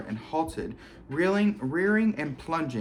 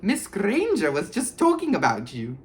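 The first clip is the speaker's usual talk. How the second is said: sly Peeves; 7 semitones higher, 4 dB louder than usual. A narrator's reading. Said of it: "said Peeves slyly".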